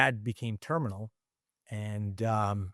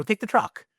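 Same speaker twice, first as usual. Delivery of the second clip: high, insistent retold offer; high generous insistence; mock insistence; 11 semitones higher than usual. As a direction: push the keys on him, voice up. Retold handing over keys.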